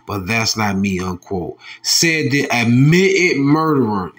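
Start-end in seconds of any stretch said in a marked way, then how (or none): none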